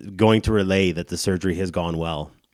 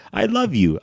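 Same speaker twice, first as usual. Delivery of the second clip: tender; 13 semitones higher, 4 dB louder than usual. Retold or imitated. Tender line to mom.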